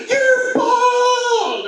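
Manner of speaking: very high, long-held mock shriek; exaggerated small-boy scream, slow and piercingly high; imitated child's scream. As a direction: stretch it out in a sing-out kid's holler. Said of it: "decides to scream at the top of his lungs".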